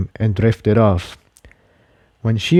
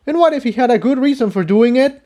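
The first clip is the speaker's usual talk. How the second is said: higher, fast comeback; higher colt voice, retorting; 14 semitones higher, faster than usual. Acted. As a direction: fire back quickly. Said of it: "Jigsaw retorted".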